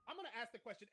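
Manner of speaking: ordinary talk, nothing marked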